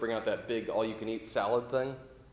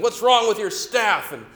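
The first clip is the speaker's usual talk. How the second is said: furious yelling; 8 semitones higher, 6 dB louder than usual. Retold or imitated. Angry dad yelling at the waitress over tomatoes, furious shouting. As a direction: yell at the waitress like an enraged father. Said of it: "He starts yelling at the manager".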